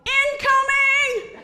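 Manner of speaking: very high, loud, slow drawn-out yell; drawn-out incoming alarm yell; war cry. Retold or imitated. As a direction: warn of incoming fire. Ducking team yells.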